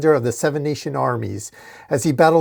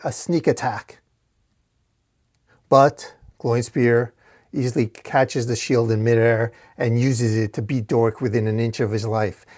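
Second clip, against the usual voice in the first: ordinary delivery, nothing marked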